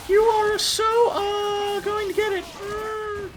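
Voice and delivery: high cartoon heroine voice; high girly heroine voice. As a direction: play the plucky captive girl. Heroine voice menace.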